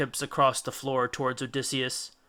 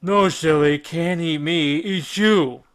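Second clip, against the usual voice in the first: louder, sing-song giant; drunk Cyclops; 3 semitones higher, 7 dB louder, slower than usual. A narrator's reading. Polyphemus telling Odysseus he will eat him, drunkenly amused. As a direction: tease him like a drunk monster.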